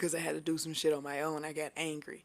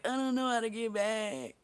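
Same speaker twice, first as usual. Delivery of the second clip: helpless plea; 5 semitones higher than usual. Retold or imitated. Retells own helpless plea to friend.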